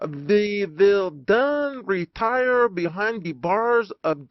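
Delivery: a pompous Dutch professor giving battle orders; Dutch doctor. Acted. Dutch doctor speaks, says mit.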